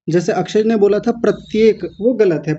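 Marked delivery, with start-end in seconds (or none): none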